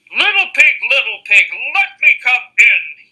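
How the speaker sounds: loud, high wolf voice; growling wolf. Acted. Wolf at the straw house door, loud growling call. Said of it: "he growled".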